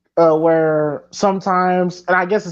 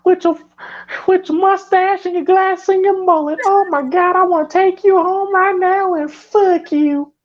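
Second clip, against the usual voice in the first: much higher, hammed-up lovestruck waitress; swooning waitress voice; 9 semitones higher than usual. Mocking the diner waitress drooling over Mister Christie, high hammed-up swoon. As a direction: gush over him like a horny diner waitress, mock breathless. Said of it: "I don't know why I'm talking like that".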